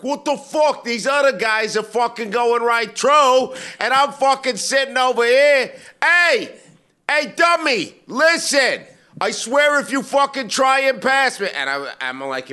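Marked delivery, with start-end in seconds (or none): 0.0-11.5 imitating a driver screaming out his window, much higher and louder; full-throated road-rage rant; hammed-up enraged stranger yelling; furious yelling. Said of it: "This guy behind me starts yelling like".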